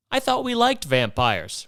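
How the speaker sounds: teasing the ladies, drawn out; mock innocent. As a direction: lay the irony on. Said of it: "repeats the line".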